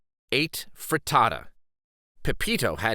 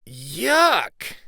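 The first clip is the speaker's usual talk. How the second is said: disgusted yuck; 4 semitones higher, 4 dB louder than usual. Acted. Eggs packed in backpack.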